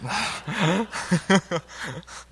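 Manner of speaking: a short burst of laughter; embarrassed laugh. Real, not acted. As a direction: laugh at your own slip. Laughs at own joke.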